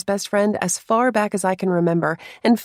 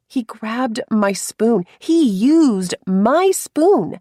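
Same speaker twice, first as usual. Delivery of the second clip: giddy; 3 semitones higher, much slower than usual. A narrator's reading. Giddy inner excitement over crush.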